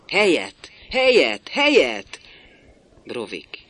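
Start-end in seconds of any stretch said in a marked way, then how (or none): 0.1-2.2 each word louder and higher, bitter; bitter mocking cry. A narrator's reading. Builder mocking the young who want room, bitter rising cry. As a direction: spit the word out three times in scorn. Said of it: "Keserűen nevet".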